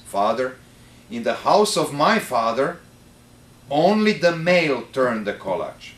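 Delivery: very serious voice. Retold or imitated. The host tells the priest his father's rule, grave and loud. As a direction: lay down the family rule solemnly. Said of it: "with a very serious voice".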